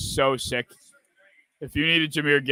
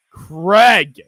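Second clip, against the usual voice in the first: loud, higher shout of the name; cheering shout; 4 semitones higher, 11 dB louder than usual. Real, not acted. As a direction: yell the name as the catch lands. Cheering a catch.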